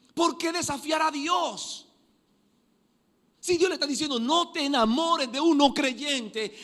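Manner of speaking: high, loud pulpit fervour; impassioned sermon voice; full-force preaching. Acted. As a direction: hammer the warning at the congregation.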